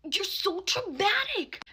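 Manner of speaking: high, drawn-out mimicked whine; mock him with a high, slow complaint; mock whiny retort. Guy's second retort, mimicked.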